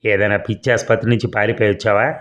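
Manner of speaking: plain reading voice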